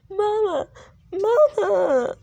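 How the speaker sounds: put-on high child's cry, calling out; little girl crying Mama. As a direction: call for mama like a small child. Child running to her mother.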